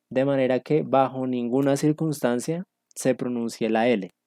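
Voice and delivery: ordinary talk, nothing marked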